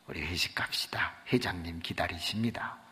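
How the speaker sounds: low whispered message; hushed whisper. Retold or imitated. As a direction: lean in and murmur respectfully. 상무가 조용히 전함. (상무가 낮게 속삭이듯)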